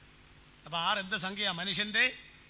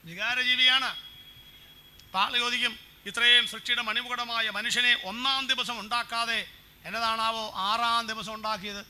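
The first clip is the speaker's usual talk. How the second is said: loud, higher preaching voice; 3 semitones higher, 8 dB louder than usual. Acted. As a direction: preach it out to the hall. Preacher raises voice to congregation. (ഉച്ചത്തിലുള്ള പ്രസംഗ ശൈലി)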